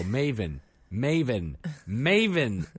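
put-on calling the child with rising urgency; three calls growing from firm to loud; calling out, building.

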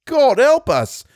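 high, astonished bellow. A narrator's reading. Soldier to the man next to him in the trench, bellowing. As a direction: bellow it out with the pitch shooting up. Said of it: "he bellowed to the man next to him".

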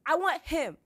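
high, loud, rapturous insistence; starry-eyed girl pleading loudly; insistent. A narrator's reading. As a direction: gush stubbornly at full volume. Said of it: "Sansa insisted".

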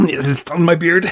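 laughing. Real, not acted. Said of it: "stuff your face full of spaghetti".

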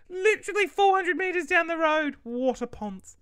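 high, loud exclamation; loud indignant outburst. Real, not acted. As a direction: exclaim it in comic outrage. Outburst over needing delivery.

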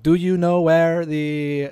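slow English in a mock Spanish accent; imitated Argentine accent. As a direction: pick out each word with an Argentine accent. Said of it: "but with an Argentine accent".

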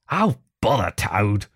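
understated savage dismissal. A narrator's reading. Rat fed up with Toad, mildly savage. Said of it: "said the rat savagely".

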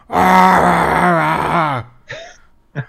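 loud, drawn-out mock wail; imitated wrestler wailing. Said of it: "they just sit there going".

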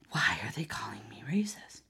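soft unvoiced puzzled mutter; confused whisper. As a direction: hush it like you're baffled to yourself. Confused poster quoted, whispered.

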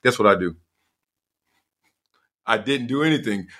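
ordinary talk

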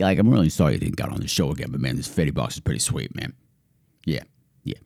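low, soft laid-back dude; low, quiet bro voice; mock deep dude voice.

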